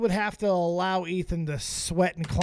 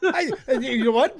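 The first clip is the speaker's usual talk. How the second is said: usual speaking voice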